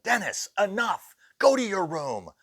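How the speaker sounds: put-on sharp, high, loud telling-off of a child; stern scolding. Staff member scolding the ghost boy: loud stern telling-off. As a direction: send the naughty kid to his room.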